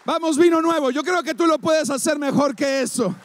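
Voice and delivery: voz alta y aguda de arenga; arenga animada. Real, not acted. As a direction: anima con fuerza a la congregación. Anima a la congregación a aplaudir. (arenga enérgica para pedir aplausos)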